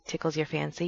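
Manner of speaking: ordinary talk, nothing marked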